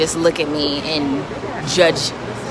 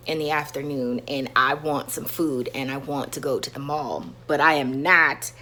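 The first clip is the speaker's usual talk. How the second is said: usual speaking voice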